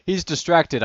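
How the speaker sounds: high, loud called-out warning; shout. A narrator's reading. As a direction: shout it to a teammate in battle. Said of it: "I heard an Internet shout".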